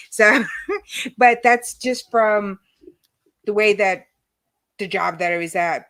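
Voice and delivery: ordinary talk, nothing marked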